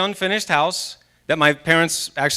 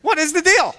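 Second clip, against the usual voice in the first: frustrated inner voice; 10 semitones higher than usual. Retold or imitated. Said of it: "So I'm going".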